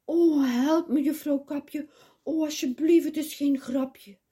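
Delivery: hoog en luid smekend om hulp; hulpgeroep. Acted. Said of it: "Big riep". (het biggetje smeekt Roodkapje hoog en luid om hulp)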